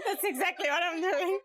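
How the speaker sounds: shouted through real laughter; laughing shout. Real, not acted. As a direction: yell it while cracking up. Giggling blooper outtake.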